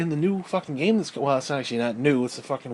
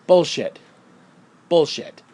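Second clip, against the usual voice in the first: Bullshit barked out loud; shouted bullshit; 6 dB louder, slower than usual. Acted. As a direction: yell Bullshit in disgust. Ranting about Princess Peach.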